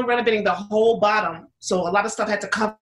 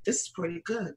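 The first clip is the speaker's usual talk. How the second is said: said calmly and much softer, low-key; 4 semitones lower, 11 dB quieter than usual. Retold or imitated. Sister calmly admitting the pizza is good, much softer. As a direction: understate the praise, calm and quiet. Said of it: "she just calmly said".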